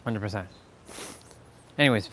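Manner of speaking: ordinary delivery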